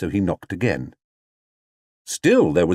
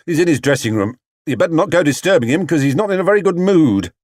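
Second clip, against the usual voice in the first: raised, hurried stagehand voice; stagehand warning; 8 semitones higher, 4 dB louder, faster than usual. Acted. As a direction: warn him off quickly. Stagehand warns Paddington.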